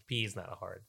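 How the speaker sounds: normal talking voice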